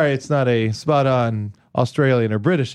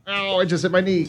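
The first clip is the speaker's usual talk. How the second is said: yelping in sudden pain; 5 semitones higher than usual. Real, not acted. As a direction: cry out from a bumped knee. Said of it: "Joe is hobbling around".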